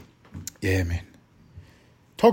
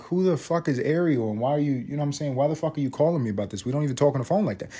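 softer, fast and baffled; quick confused retort; 4 dB quieter, faster than usual. Retold or imitated. Telling his friend on the phone why he's baffled, quick and soft. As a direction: rattle it off, confused. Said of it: "I'm like".

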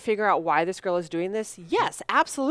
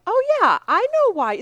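very high, bright robot voice; 13 semitones higher, 6 dB louder than usual. Retold or imitated. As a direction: pitch way up and chirp it like a friendly robot. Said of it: "first robot who's like".

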